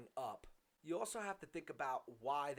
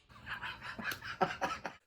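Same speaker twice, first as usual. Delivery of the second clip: laughter; 6 dB louder than usual. Real, not acted. Laughs at the chant.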